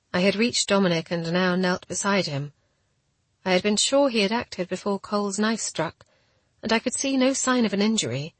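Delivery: ordinary delivery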